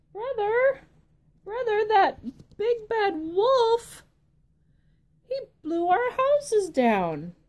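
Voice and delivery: high, loud panicked piglet squeal; frightened little pig. Acted. Little pig running to his brother, high panicked squeal. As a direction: squeal for help like a terrified pig. Pigs tell oldest brother.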